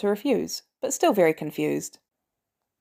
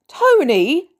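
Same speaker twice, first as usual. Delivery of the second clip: loud, high exasperated call of the name; scolding shout; 8 semitones higher, 7 dB louder than usual. Acted. Pepper scolding Tony for laughing, loud exasperated call. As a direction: snap his name, fed up.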